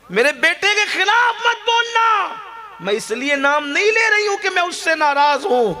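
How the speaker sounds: put-on old mother scolding the staff, indignant and high. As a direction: cry out like a wounded mother. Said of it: "بڑی بی کو غصہ آ گیا".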